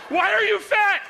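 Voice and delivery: high, loud accusing question; demanding wife. Acted. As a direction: fire it off like an annoyed wife.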